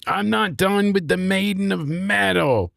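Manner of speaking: high-pitched, grating wrestler imitation; grating mock voice. Host mimicking Nikita on the mic, high and grating. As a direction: whine it out high, mocking her promo. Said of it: "like, very grating".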